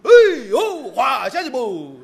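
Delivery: loud, drawn-out mocking catcall; jeering heckle. Spectators jeer an actor off stage, shouted heckling. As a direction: holler like a rowdy theatre crowd. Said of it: "道好是起哄".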